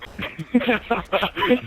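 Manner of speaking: high burst of laughter; startled laugh. Real, not acted. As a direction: laugh out in shock. Reacts to game death.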